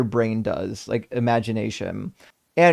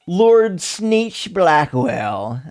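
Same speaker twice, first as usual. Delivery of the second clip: villain name; 4 semitones higher, much slower than usual. Pretend.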